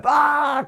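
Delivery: sudden loud, high yell; imitated bellow. Therapist mimicking a client's sudden bellow during treatment, imitated bellow. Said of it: "começa um berro outra vez".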